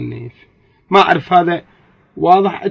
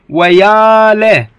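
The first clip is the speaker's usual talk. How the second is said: "ويا ل-" stretched very slow and loud; drawn-out demo; 5 semitones higher, 7 dB louder, much slower than usual. Shown as a demonstration. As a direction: drag out "ويا ل-" loudly. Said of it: "عطف عليها شنو". (مدّ "ويا ل-" ببطء وبصوت عالٍ)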